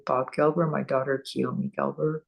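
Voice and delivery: ordinary talk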